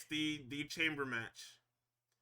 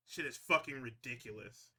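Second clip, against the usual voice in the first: ordinary talk, nothing marked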